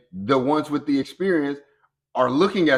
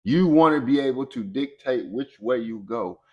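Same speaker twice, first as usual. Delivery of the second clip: ordinary delivery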